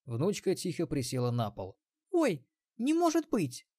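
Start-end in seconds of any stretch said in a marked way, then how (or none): 2.1-3.7 put-on much higher bright girl voice; young girl. Granddaughter voiced by narrator.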